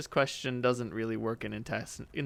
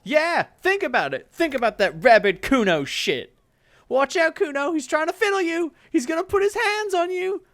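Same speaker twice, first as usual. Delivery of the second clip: high, loud yelling brat; a kid raising a false alarm; bratty kid Kuno; 9 dB louder than usual. Acted. Kuno yells to the street, high and loud.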